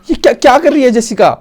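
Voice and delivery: loud, high-pitched disbelief; shocked shouting. Real, not acted. Gamer appalled at Jessica in the game, shocked shouting. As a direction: demand to know what she's doing, scandalized.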